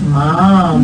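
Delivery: put-on loud, crisp Mom for the child; over enunciating. Shown as a demonstration. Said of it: "Try again".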